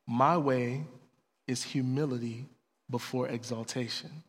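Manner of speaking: low, soft fatherly correction; quiet, patient counsel with long pauses; very gentle. Retold or imitated. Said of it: "God very gently says".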